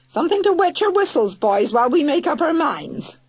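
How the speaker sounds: high, loud sweet call. Acted. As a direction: call it out like a hostess. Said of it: "she called".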